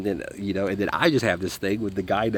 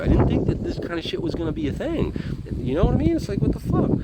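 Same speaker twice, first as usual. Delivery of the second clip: exasperated. Real, not acted. Exasperated rant about unlocked meds.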